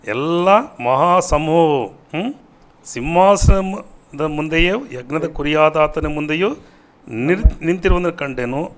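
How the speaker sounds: loud, high, stretched-out preaching; rousing scripture proclamation. Acted. Preacher shows viewers the great multitude in heaven, rousing proclamation. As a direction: punch each phrase out with rising endings.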